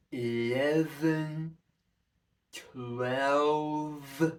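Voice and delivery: slow count with the tongue pressed behind the top teeth. Shown as a demonstration. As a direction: count slowly with the tongue pushed forward. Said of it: "tip of the tongue behind the top teeth".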